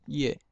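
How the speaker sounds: 叶 as Hokkien "ia̍p"; lips closed at end. Shown as a demonstration. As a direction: end 叶 on a shut-lipped p, "iap". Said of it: "最后嘴巴紧".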